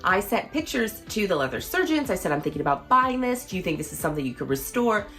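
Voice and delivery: usual speaking voice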